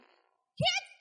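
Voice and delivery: put-on high shrill hurry-up yell at the kids; mock frazzled mom shouting orders; hammed-up mom yelling at the kids to clean up. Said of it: "the mom that have been like".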